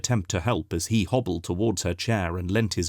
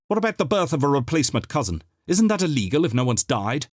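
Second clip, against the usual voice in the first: fast, raised heckle from the crowd; calling out; 6 semitones higher, faster than usual. A narrator's reading. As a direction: shout it over the crowd. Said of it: "Somebody called out".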